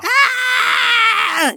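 high, sharp scream while being thrown; falling scream. Pretend. Player voicing being tossed out the window, yelping in a high scream. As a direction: yelp as you fly out the window.

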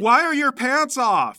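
loud, high, drawn-out question; appalled. Acted. Son confronts his half-dressed father, appalled. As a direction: demand it in slow, loud disbelief.

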